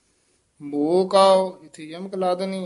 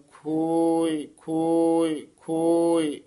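ਖੋਏ ਖੋਏ ਖੋਏ drawn out slowly with a stop on each; ਖੋਏ ਖੋਏ ਖੋਏ slow, spaced chant; slow measured recitation; much slower than usual. Shown as a demonstration. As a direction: hold each ਖੋਏ apart, slow and deliberate. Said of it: "ਇੱਥੇ ਠਮਕ ਲਾ ਦੇਣੀ ਹੈ".

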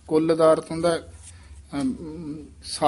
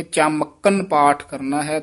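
ordinary talk

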